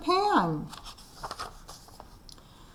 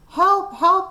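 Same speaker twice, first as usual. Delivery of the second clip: urgent call for help; 8 semitones higher, 4 dB louder than usual. Acted. Policeman radios for help.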